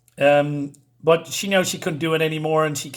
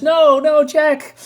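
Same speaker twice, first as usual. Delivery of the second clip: high, loud cry of protest; alarmed woman; 10 semitones higher, 8 dB louder, slower than usual. Acted. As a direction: plead in a raised, high-pitched woman's voice. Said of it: "And she says".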